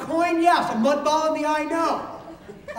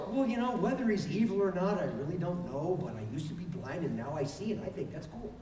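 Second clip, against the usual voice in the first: soft, laid-back reply; 9 semitones lower, 9 dB quieter than usual. Acted. Healed man answers leaders calmly.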